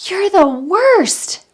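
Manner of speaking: put-on high, loud, slow hurled insult from a kid; child lashing out. Coach voicing a child insulting the parent, high and slow. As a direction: throw it at the parent like an upset child. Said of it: "they throw something at us like".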